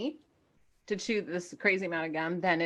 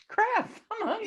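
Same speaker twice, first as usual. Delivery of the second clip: laughing; 14 semitones higher than usual. Retold or imitated. Mock panic, laughing.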